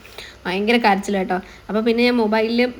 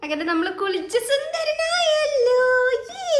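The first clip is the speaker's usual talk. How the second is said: much higher, slow cooing praise; 12 semitones higher, slower than usual. Real, not acted. Mother coos to her son after his bath.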